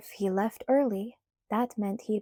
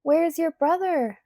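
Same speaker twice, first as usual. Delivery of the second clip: shouting; 8 semitones higher, 5 dB louder than usual. A narrator's reading. Shouting over loud car music.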